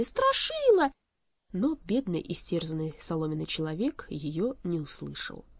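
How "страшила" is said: very high, loud desperate call; crying out. A narrator's reading. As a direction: shout the name with all urgency. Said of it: "закричала Элли".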